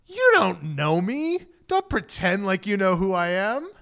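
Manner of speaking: slow, drawn-out offended challenge, rising at the end; acted indignant skepticism; affronted. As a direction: push back like someone insulted by a stranger's familiarity. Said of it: "you have to imagine the gall".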